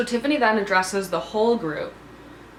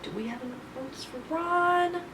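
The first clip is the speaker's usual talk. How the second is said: high and much softer; soft, tense aside while counting votes; nervous hush; 5 semitones higher, 7 dB quieter than usual. Real, not acted. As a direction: ask it under your breath, anxious. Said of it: "Very, very nervous".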